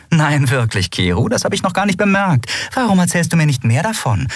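high, quick mock astonishment; exaggerated, high-pitched sarcasm; sarcastic retort. Sarcastic retort to Keru's warning.

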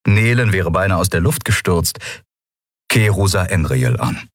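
ordinary delivery, nothing marked

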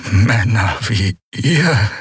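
high, slow, each syllable a struggle; tormented, gasping. Acted. Dying prisoner forcing out words, tormented. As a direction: squeeze the words out in agony. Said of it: "von jeder Silbe gequält".

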